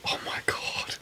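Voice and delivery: very soft, breath-only reverence; awed whisper. Retold or imitated. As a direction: whisper it as if overcome by the taste. Savoring remembered iced tea.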